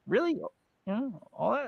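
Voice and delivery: mock disbelief, rising at the end; skeptical. Host shows guest a doubter's reaction, skeptical. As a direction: question it softly and doubtfully. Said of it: "does that make you skeptical?".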